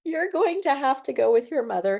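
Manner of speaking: high voice, laughing through the words; high, amused letdown to a toddler; laughing apology. Retold or imitated. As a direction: gently turn the child away while laughing.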